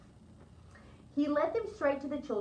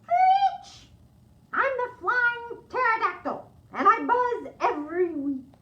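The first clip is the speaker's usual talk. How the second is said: very high, loud screech then high voice; screeching pterodactyl; 12 semitones higher, 9 dB louder, slower than usual. Acted. Child in pterodactyl costume greeting the librarians, loud screech. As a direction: shriek like a flying dinosaur. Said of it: "some other creatures who would like to say hello".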